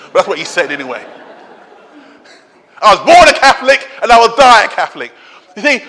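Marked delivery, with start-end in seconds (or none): 2.8-5.1 a laughing, quicker, badly faked Irish accent; attempted Irish accent.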